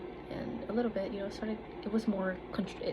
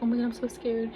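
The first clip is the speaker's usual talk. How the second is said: retold panic; 4 semitones higher, 6 dB louder than usual. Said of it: "I was like".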